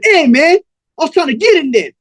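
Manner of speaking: high, loud-ish protest; young man protesting. Acted. Young man protesting to Cold Blood, high-pitched complaint.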